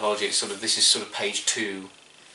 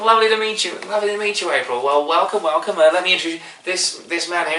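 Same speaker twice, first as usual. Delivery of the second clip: high, loud, gushing welcome; effusive hostess; 8 semitones higher, 6 dB louder, faster than usual. Acted. Kimberly welcomes April into her group, high and effusive. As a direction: welcome the new guest effusively.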